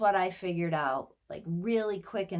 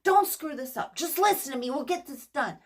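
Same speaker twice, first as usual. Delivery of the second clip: higher, louder, pushy manager; bossy manager voice; 6 semitones higher, 5 dB louder than usual. Retold or imitated. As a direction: bark orders like a stressed boss. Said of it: "A manager that's just trying to get things done".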